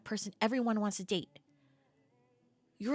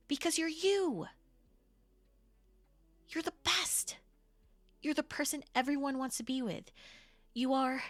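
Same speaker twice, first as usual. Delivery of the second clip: earnest reassurance; 5 semitones higher than usual. Acted. Reassuring friend after being ghosted.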